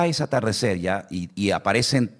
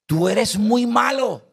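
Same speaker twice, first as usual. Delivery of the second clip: high, slow, sulky mock reproach; cross sister; 13 semitones higher, 3 dB louder, slower than usual. Offended sister scolds the pastor, high and sulky. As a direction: pout it out like an offended old lady. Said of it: "Se enfadó conmigo y me dice".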